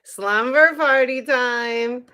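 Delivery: long stretched playful cheer; party announcement. Pretend. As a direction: sing it out slowly like a party kickoff. Announces party, drawn-out call.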